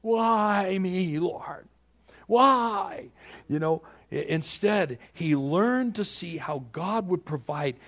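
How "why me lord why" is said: high, whiny moaning to God; whining complaint. Retold or imitated. Preacher mocking a whiner complaining to God. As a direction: whine like a self-pitying believer. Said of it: "not as something to whine about".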